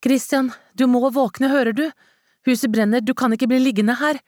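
high, piercing panicked scream; shrill scream. A narrator's reading. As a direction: shriek it, desperate to wake him. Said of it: "skrek hun med en skingrende stemme".

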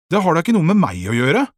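high, loud protest; indignant objection. A narrator's reading. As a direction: push back defensively. Boy rejects the comparison in argument.

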